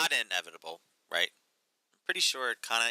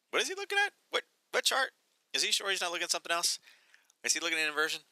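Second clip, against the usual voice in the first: much higher, incredulous squeak; mock disbelief; 9 semitones higher than usual. Mocking an analyst's headline, in a high incredulous voice. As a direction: play a baffled trader squinting at the chart.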